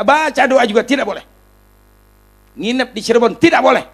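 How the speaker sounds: high, emphatic prohibition; imitated stern refusal. Official banning prayer and lodging, high and emphatic. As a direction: forbid it loudly, as a mock official.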